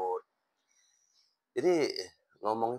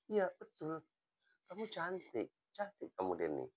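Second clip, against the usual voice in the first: high, soft, sincere reassurance; reassuring compliment; 10 semitones higher, 7 dB quieter than usual. Retold or imitated. Teacher assuring student Denny she is beautiful, gently sincere.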